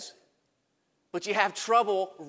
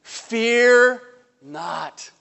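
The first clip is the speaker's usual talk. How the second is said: loud, ringing voice of God; God's command; 5 semitones higher, 8 dB louder than usual. Acted. God calling out to Abram, loud and commanding. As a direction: proclaim it with divine authority. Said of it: "Listen to what he says".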